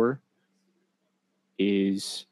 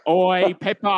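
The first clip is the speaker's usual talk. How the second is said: higher mock Aussie call; Australian accent; 6 semitones higher, slower than usual. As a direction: shout it like a cartoon Aussie. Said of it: "you be Crocodile Dundee".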